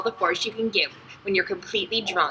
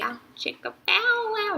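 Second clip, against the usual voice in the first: high, playful vocal riff; jokey sung-out wah sound; mock bow-chicka-wow riff; 14 semitones higher than usual. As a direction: imitate a cheesy guitar lick. Imitates cheesy porn music riff.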